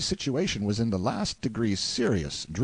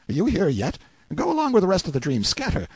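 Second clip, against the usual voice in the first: higher, louder, impatient outburst; indignant dismissal; 9 semitones higher, 5 dB louder, faster than usual. A narrator's reading. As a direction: shoo away a dream figure who won't leave. Dismisses Clarence as a dream.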